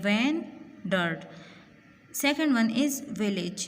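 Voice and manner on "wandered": "wandered" in two separate beats; split into syllables. Shown as a demonstration. Teacher showing students two syllables, "wandered" said in two parts. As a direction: break "wandered" after the first syllable, with a gap. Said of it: "इसमें टू syllables है".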